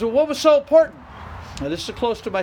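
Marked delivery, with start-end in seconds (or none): 0.0-1.0 loud, high, indignant retold question; mock outraged shout of a miffed friend; indignant demand. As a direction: fire it back like an offended friend. Friend demands reason for ignored call.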